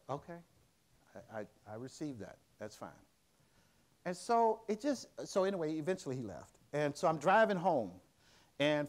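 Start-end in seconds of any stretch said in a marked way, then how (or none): 0.1-2.9 low, soft, halting reply; retold quiet acceptance. Teacher receiving a stranger's apology, quietly accepting. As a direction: gently take it in, voice dropped.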